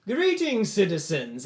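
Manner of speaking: high, loud public greeting; grand hail. Acted. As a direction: hail the townsfolk grandly, first word pitched high. Lord hails a town's peasants.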